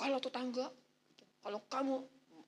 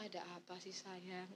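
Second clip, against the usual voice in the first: soft, low tender question; calm the wife down gently; hushed loving husband; soothing husband; 4 semitones lower, 13 dB quieter than usual. Retold or imitated.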